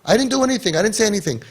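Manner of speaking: high-pitched imitation of defensive denial; high, put-on plea of innocence; mock innocent protest. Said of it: "People reject and they say".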